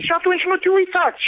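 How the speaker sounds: yelled high and loud at the caller; angry shout. Real, not acted. Accused neighbour yelling back at the caller angrily. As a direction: snap back, furious and loud.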